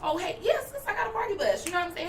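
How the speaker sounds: high bubbly hey-sis voice; excited casual greeting. Shown as a demonstration. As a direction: gush at her like an old friend.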